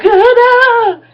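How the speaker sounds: high, loud mocking whine; a high put-on voice mimicking a reluctant partner; mock squeaky protest. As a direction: squeal it like a whiny refusal. Peter mock-quoted in high squeal.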